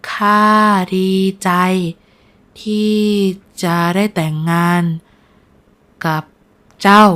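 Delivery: frail, halting sincerity; sincere. A narrator's reading. Ailing nobleman glad to marry her, sincere. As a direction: speak earnestly, word group by word group. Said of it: "คุณชายพิชิตพูดด้วยน้ำเสียงจริงใจ".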